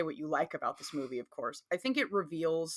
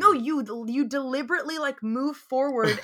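playful accusing; 6 semitones higher, 5 dB louder than usual. Real, not acted.

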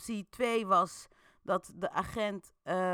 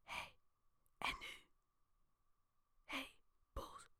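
hushed unvoiced whisper; whispering to Boels in the dark; 8 semitones higher, 17 dB quieter than usual. A narrator's reading. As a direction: whisper so the police can't hear. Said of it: "Je fluistert".

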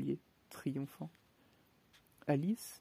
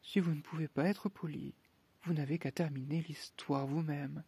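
plain reading voice

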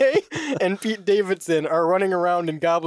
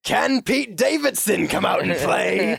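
slow, drawn-out movie villain taunt; Willem Dafoe; 4 semitones higher, slower than usual. Acted. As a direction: tease it out like a film goblin. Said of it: "Classic Willem Dafoe".

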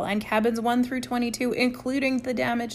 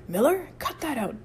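mild bellow, pitched up; 5 semitones higher than usual. A narrator's reading. Chip ordering Miller to stop listing activities, bellowing. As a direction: cut him off with a raised, commanding voice. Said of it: "bellowed Chip".

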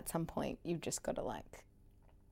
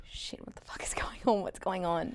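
panicked hushed whisper; alarmed whisper; 6 dB quieter than usual. Retold or imitated. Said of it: "he looked at me like".

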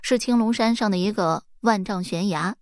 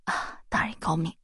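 flattering; 3 semitones lower, 6 dB quieter than usual. Acted. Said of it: "师爷恭维说".

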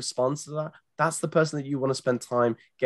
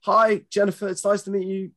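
eager opener; 6 semitones higher, 3 dB louder than usual. Retold or imitated. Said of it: "if you get on the chat and go".

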